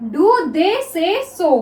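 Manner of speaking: each word drawn out slowly for students; very slow classroom dictation; slow dictation read. Shown as a demonstration. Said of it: "next देखिए".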